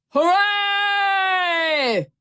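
high, long-held pirate cheer. A narrator's reading. The pirates celebrate the squealing pig, cheering. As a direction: stretch it into a shrill whoop. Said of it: "the pirates cheered".

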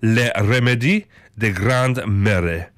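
slow, careful French phrase; attempted French accent. Shown as a demonstration. Telling viewers how they say it in France, attempted French accent. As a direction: read it out slowly in a rough French accent. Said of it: "get better at these accents".

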